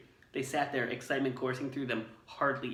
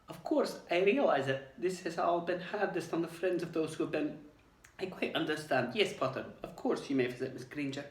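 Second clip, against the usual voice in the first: croaky, moved teacher; 7 semitones higher than usual. Acted. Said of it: "in a strangely croaky voice".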